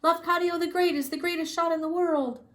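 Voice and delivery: high, loud chant; crowd shouting. A narrator's reading. Audience shouting praise for Lafcadio, high and loud. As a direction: shout like cheering fans. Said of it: "the people began to shout".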